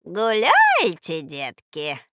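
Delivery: slow crooning, swooping down; sugary voice. A narrator's reading. Stranger cooing to the children outside, sugary. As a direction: coo sweetly like an old woman. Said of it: "сладким голосом поинтересовалась незнакомка".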